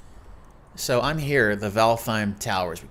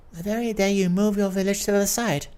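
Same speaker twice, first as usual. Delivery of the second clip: high lady voice; 10 semitones higher than usual. Acted. Duchess voiced in read story.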